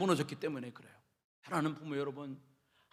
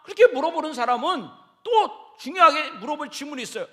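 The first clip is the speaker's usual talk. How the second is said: usual speaking voice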